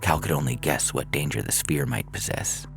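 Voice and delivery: plain reading voice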